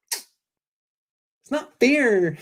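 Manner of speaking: very slow, high mock whine; whiny kid. Host imitates his childhood complaining, high drawn-out whine. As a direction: drag it out like a sulking child. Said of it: "I used to talk like that when I was a kid".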